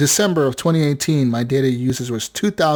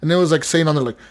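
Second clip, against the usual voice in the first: usual speaking voice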